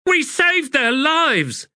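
high, slow indignant outburst; indignant soldier blurting out; blurted. A narrator's reading. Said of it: "blurted the standard-bearer".

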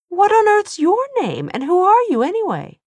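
she's had enough, demand his name; indignant outburst. Acted. Tessa indignant, pitch climbs.

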